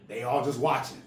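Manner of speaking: ordinary delivery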